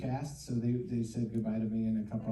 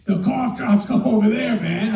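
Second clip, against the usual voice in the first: luide, hogere collegastem die bevelen geeft; loud coworker; 7 semitones higher, 12 dB louder than usual. Retold or imitated. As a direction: roep het hard en hoog als een drukke collega. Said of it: "he'd be like".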